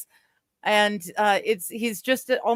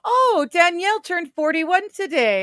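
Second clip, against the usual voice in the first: bright, high-pitched announcement; delighted high news; excited; 10 semitones higher than usual. Real, not acted. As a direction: share the birthday news with a jump up in pitch. Announcing a friend's birthday.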